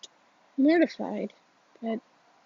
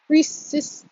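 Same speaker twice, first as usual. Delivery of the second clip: ordinary delivery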